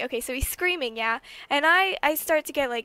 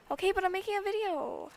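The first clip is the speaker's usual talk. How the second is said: higher, exasperated protest; annoyed insisting; 7 semitones higher, 4 dB quieter than usual. Real, not acted. Telling her mom she is mid-video, exasperated. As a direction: push back, you're busy filming.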